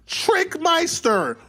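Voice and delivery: rising, stretched exasperated shout; high, drawn-out mock yell at Tricky. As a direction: draw out trickmeister, then yell. Speaker yelling at drunk Tricky.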